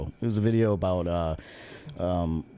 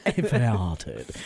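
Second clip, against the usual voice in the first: posh English "I fahted"; clipped posh Brit; mock English accent; 3 semitones lower than usual. Friend mocking English sophistication, posh English accent. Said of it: "If you hear the English accent".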